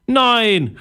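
high, loud horrified no; horrified cry. A narrator's reading. Girl recoils from leaving, horrified cry. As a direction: Josie cries out in shock. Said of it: "rief Josie entsetzt".

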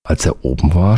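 ordinary delivery

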